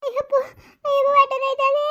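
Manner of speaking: high moaning cry of pain; acted labor pain. Woman in labor, wailing about her stomach pain. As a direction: groan out the stomach ache. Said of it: "ಹೊಟ್ಟೆ ನೋವು ಅಂತಾಳು ನೋಡವ್ವ".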